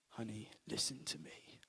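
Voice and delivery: low, soft whispered calm; Liam Neeson. Retold or imitated. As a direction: hush it like a cool-headed ex-agent dad. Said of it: "I can't do a Liam Neeson impression".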